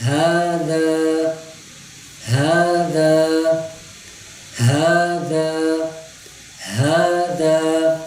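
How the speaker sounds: ہذا، ہی، وہو with a breathy ha; slow recitation drill. Shown as a demonstration. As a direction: stretch each word, ha from the chest. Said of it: "ہاں".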